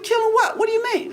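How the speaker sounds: put-on high, startled child's question; a kid blurting out in alarm; young boy squeaking in disbelief at grandma; surprised child. Child voice, much higher.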